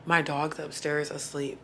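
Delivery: normal talking voice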